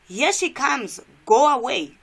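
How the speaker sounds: loud, urgent comic-strip read. Acted. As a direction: call it out, punching "go away". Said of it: "sound of my voice is a bit louder".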